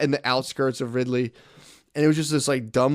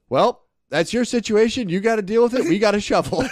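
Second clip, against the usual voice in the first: cold boss reply; 7 semitones higher, 3 dB louder than usual. Retold or imitated. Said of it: "Ajax pauses and goes".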